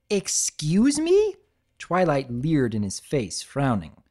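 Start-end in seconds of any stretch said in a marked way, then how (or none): 0.1-1.3 high, slow offended retort; indignant. Acted. Twilight offended by Spike's shrug, slow indignant. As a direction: stretch it out in disbelief.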